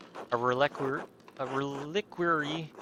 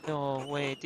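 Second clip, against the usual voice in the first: ordinary talk, nothing marked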